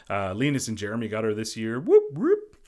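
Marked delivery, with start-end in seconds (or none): 1.8-2.6 high, loud little whoop; playful whoop of celebration; mock cheer. Cheering for Linus and Jeremy.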